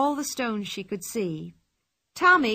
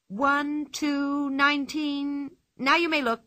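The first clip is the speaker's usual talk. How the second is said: high, sing-song counting; playful counting; 6 semitones higher, slower than usual. A narrator's reading.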